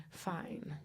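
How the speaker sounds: ordinary talk, nothing marked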